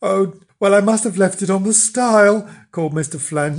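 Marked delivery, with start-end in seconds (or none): 0.0-2.5 high, loud, hesitant confession; falter, then call out the bad news; nervous raised-voice admission; not daring. A narrator's reading. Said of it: "not daring to go back".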